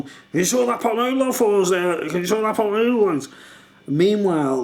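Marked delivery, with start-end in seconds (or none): 0.0-3.3 mimicking Andy. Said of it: "And he's going".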